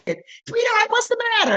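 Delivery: lifts way up into a high, gushing sweetheart voice; high, gentle imitation of a clerk; high camp voice.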